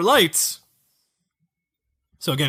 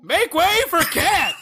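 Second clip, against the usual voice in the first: Cat's voice; 12 semitones higher than usual. Acted. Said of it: "That's what she has to say".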